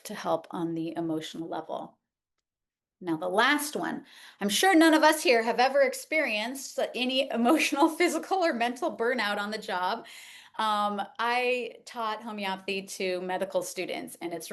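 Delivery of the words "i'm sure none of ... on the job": dry sarcasm that breaks into a laugh; sarcastic. Real, not acted. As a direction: tease the tired crowd with mock innocence. Ironic joke to burned-out audience.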